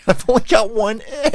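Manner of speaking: high squeaky mock complaint; mock whiny high voice. Host mock-whining to cohost about letters, high squeak. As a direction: whine it up high like a cartoon.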